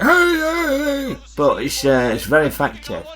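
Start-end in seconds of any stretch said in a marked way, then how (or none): 0.0-1.2 high, hoarse mock shout; lightly imitating a film boss yelling; mock Duvall shouting. Reviewer copies Duvall's hoarse shouting, half-hearted. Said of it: "Sounds very hoarse when he does it".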